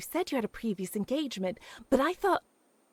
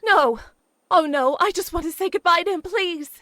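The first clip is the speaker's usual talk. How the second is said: desperate plea; 5 semitones higher, 8 dB louder than usual. Acted. Begs friend to keep phone.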